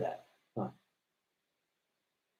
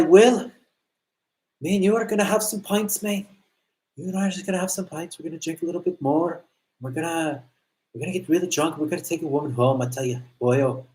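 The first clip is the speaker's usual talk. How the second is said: imitating a drunk buddy's Irish accent, raised higher; a pub-bound Irishman egging on his mate, pitched up; 4 semitones higher than usual. Drunk Lucas urging his friend to drink, in an Irish accent. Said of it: "he would start talking like this".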